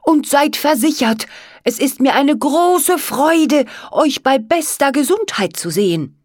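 high, effusive frog servant, pleased; courtly frog servant. Acted. Frog servant greets his friends.

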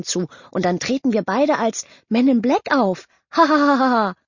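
high, fawning mock voice with a fake laugh; mocking a suck-up. Kevin mimics the suck-up praising the outfit, high and fawning. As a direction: gush like a flatterer, then a hammed-up laugh. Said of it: "machte die Stimme des allseits bekannten Schleimers nach".

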